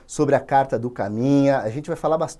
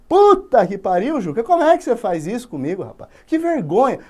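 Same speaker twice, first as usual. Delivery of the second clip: louder, higher outrage; mock outraged father; 6 semitones higher, 5 dB louder than usual. Father berating his son for his shame. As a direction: lay into him, disgusted. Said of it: "O pai esculacha o moleque".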